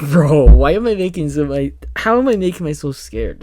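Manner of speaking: loud laugh-through at own jumpiness; giggling at being spooked; laughing disbelief. Real, not acted.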